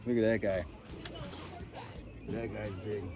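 ordinary talk

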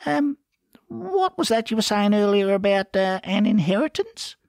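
high, hesitant, ingratiating question; suddenly polite. A narrator's reading. Ferret asks about an inheritance, sweetly polite and high. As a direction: tiptoe politely toward the subject, high-pitched. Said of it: "suddenly polite with the memory of riches".

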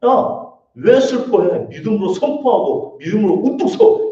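loud, high, acted rebuke; stern exhortation. God imagined pressing the grieving to stand, sternly. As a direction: push them forcefully to stand up.

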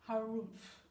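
a stubborn walrus grunt. Acted. Walrus replies.

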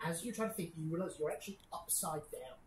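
ordinary talk

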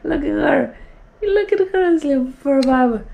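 high cooing to the dog; doting owner cooing high at the dog; high baby voice. Retold or imitated. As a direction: coo over her in a high voice. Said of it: "I'm like".

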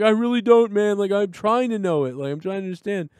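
lightly voiced confused worker; mock freaked-out pizza guy. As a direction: play the panicked employee. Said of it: "the guy was freaking out".

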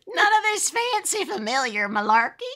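high, loud scolding granny. Pretend. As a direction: scold like a fussy old granny. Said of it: "granny voice".

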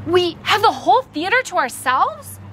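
high, incredulous question with a rising end; delighted disbelief; amazed. Acted. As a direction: ask it as if you can't believe your luck. Boy amazed at the surprise.

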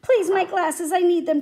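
loud, high, urgent plea; begging. A narrator's reading. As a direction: beg for them back. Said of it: "Tom begged".

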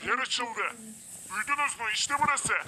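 high excited exclaiming. Real, not acted. Secret door opens. (heyecanlı, yüksek sesle)

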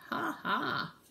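high, hammed-up ha ha; farmer's laugh. Farmer waking up with a laugh, mock laughing. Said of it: "woke up with a laugh".

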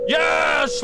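high, drawn-out victory yell; triumphant shout. Real, not acted. Gamer beating a hard puzzle, triumphant shout.

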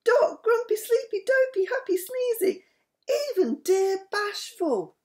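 very high, delighted princess squeal; joyful greeting. A narrator's reading. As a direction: greet each old friend in a high, thrilled voice. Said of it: "her lovely face lit up with joy".

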